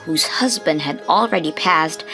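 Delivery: ordinary delivery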